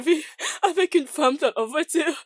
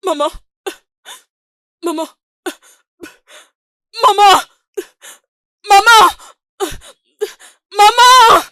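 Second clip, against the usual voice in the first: very loud cries, rising higher; screaming for mother; 4 semitones higher, 14 dB louder than usual. Acted. Daughter screams for her mother in a nightmare, shouting.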